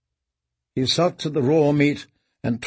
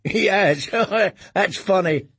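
laughing; 6 semitones higher than usual. Acted. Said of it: "The first boy laughed".

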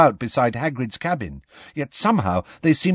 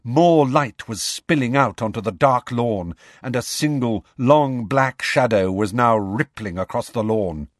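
ordinary delivery, nothing marked

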